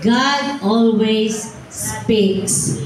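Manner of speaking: slow and gentle. Shown as a demonstration. Slows and softens on softly.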